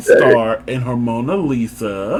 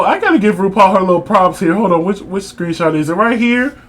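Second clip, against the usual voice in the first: usual speaking voice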